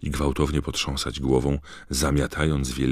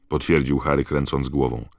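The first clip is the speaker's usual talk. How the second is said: ordinary delivery, nothing marked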